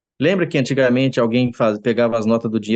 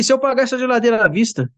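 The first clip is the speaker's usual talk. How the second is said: very high, eager voiced father; a proud man asking with glee; 12 semitones higher than usual. Proud father asks manager about paying cash, very high.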